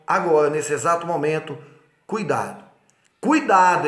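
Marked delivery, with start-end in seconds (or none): 3.2-3.9 emphatic warning. Shown as a demonstration. Law teacher warning students, loud emphatic "Cuidado". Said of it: "em letra maiúscula, negrito e sublinhado".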